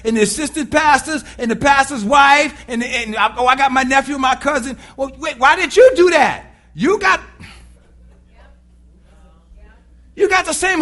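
loud, rising exasperated preaching; loud rant. Real, not acted. Preacher scolding leaders who push kids forward, loud and exasperated. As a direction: build the outrage and shout it.

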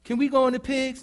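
lightly voiced pleading child; childish demons. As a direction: play the demons as whining kids. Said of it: "talked to them like children".

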